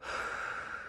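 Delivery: long shaky exhale; calming sigh; deep breath out. Real, not acted. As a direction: breathe out slowly to calm down. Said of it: "Take some deep breaths".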